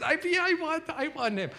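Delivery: high, eager acceptance; woman saying yes to Jesus, eager. Retold or imitated. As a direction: answer like grabbing a lifeline. Woman answering altar call.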